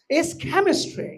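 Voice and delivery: very slow, deliberate chemistry; model pronunciation. Shown as a demonstration. Teacher correcting students, slow careful pronunciation. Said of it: "রসায়ন পড়ছে কেমিস্ট্রি".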